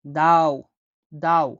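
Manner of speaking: দাও said heavy and deep, punched out loud; deep dad. Shown as a demonstration. Teacher demonstrating the heavy Arabic d, deep and punched. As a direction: land দাও deep and heavy, the d from far back. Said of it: "গভীরভাবে বলতে হবে".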